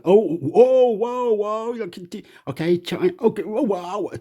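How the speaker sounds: high-pitched, hammed-up whoas; mock alarm. Acts out halting a quarrel.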